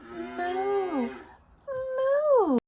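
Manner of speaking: long, high-pitched drawn-out moo; cow moo. Shown as a demonstration. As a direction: moo like a cow for little kids. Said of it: "What does a cow say?".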